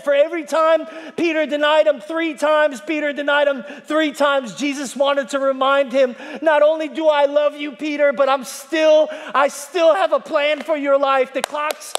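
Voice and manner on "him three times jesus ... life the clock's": high, loud preacher's shout as Jesus; preaching yell. Acted. Preacher voicing Jesus's promise to Peter, shouting. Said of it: "Man, this guy could yell".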